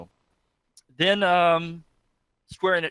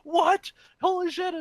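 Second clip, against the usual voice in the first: very high-pitched mock shock; high, astonished crowd reaction; imitated amazement; slower than usual. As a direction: squeal like a stunned audience. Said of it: "that's when everybody's like".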